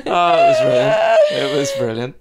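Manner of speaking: high, loud helpless laughter; laughing hard. Real, not acted. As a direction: laugh through the words. Laughing at own story.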